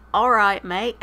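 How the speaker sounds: loud, playful attempt at British; hammed-up brash British greeting; brash British accent. Streamer obliging a viewer's accent request, mock British.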